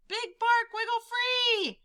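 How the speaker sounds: high, slow, urgent call upward; shouted. Acted. Prairie dog calling up to Big Bark, high shout. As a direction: call up to him in the sky. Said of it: "the prairie dog shouted".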